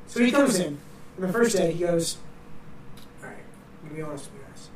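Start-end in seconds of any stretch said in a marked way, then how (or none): 3.2-4.7 imitating a very soft-spoken teacher, much softer; quiet professor. Professor opening first class, imitated very quietly. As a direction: drop way down, mild mock mumble. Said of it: "Very quiet guy".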